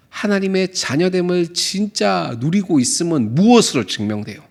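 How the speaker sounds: ordinary delivery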